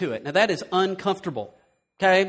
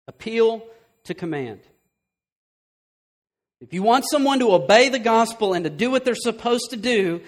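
usual speaking voice

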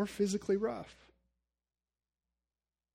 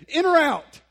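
loud high bark; curt shout at Sheila in the door; snapping at wife; 12 semitones higher, 13 dB louder than usual. Retold or imitated. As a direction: bark it sharply at her in the doorway. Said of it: "and I just said".

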